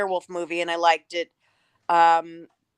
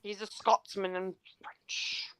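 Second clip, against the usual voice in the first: whispered aside, softer; 11 dB quieter, slower than usual. Real, not acted. Whispered joke to friends about the actor. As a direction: breathe it as a secret.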